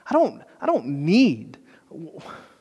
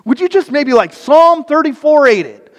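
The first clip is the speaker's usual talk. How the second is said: much higher, louder urgent plea; God leaning in, begging you to stop; God's voice; 11 semitones higher, 7 dB louder than usual. Acted. God pleads with his people to stop, high and urgent.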